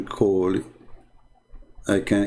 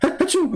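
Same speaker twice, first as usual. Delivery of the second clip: high falling mock sneeze; fake sneeze. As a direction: sneeze it downward, squeaky.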